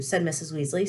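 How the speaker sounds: usual speaking voice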